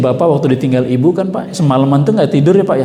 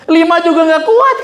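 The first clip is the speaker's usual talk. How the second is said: high voice with a laugh in it; laughing protest; 12 semitones higher, 3 dB louder than usual. Acted. [name] laughing that five is still too heavy. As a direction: tease that even five is too much.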